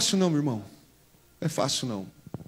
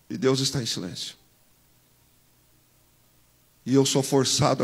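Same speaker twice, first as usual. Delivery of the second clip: quiet; 6 semitones lower, 8 dB quieter than usual. Real, not acted. Drops to quiet testimony.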